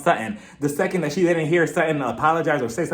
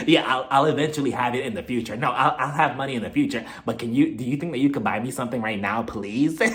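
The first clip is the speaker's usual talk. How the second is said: mock begging; 4 semitones lower than usual. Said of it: "She's like".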